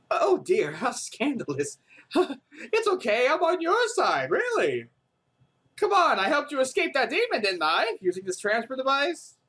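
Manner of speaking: high, teasing, falsely sweet; coy inventor. Acted. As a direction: coo it like a sly girl hiding something. Yui teasing, evasive.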